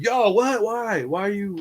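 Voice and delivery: high-pitched, baffled sputter; bewildered protest. Retold or imitated. Man answers a hostile inmate in bewildered, high protest.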